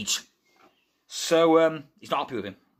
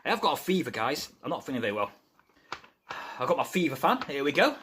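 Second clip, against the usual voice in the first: ordinary delivery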